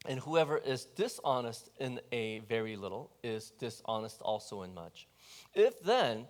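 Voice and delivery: low, soft, measured Bible reading; scripture reading. Acted. Preacher reading the Gospel to the congregation, solemn and measured. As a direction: read the passage like a lector. Reads the scripture passage aloud.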